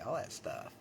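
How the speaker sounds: normal talking voice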